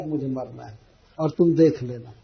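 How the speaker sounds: a wife threatening to die to scare him; mock sulky threat. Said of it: "चाहे बिजली का current छू करके". (धीमी रूठी धमकी)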